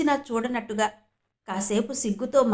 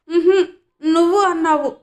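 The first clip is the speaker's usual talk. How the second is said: put-on high, loud childish pout with a little laugh; sulky little boy; 6 semitones higher, 9 dB louder than usual. Raghubabu accuses Parvati, pouting like a child with a laugh.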